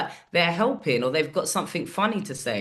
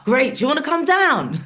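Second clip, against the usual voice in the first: high, loud, quick ask; eager invite; 9 semitones higher, 6 dB louder than usual. Retold or imitated. James asking the comic to come down, high and eager. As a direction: pounce on the yes.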